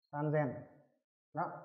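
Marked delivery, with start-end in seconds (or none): 0.1-0.5 softer, lower Sanzen with a z; Sanzen, second half starting on z; voiced z in Sanzen. Shown as a demonstration. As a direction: repeat Sanzen quietly, zen rather than sen. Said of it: "Chúng ta cần phải chú ý".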